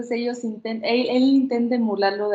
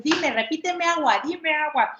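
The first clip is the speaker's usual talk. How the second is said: pushy demand; 8 semitones higher than usual. Shown as a demonstration. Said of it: "el estrés de que a fuerzas".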